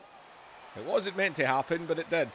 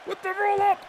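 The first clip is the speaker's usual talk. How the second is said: high, loud, breathless wrestling commentary; excited play-by-play; 9 semitones higher, 3 dB louder than usual. Acted. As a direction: call the match like every move is a shock.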